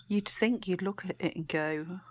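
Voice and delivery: normal talking voice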